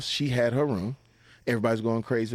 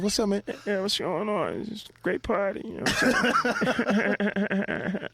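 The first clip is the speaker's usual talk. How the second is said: high breezy voice; 8 semitones higher, slower than usual. Retold or imitated. Chatting with [name].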